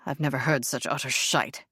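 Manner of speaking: a hint of a furious fiancé; lightly voiced angry man. A narrator's reading. Alistair's curt lower voice.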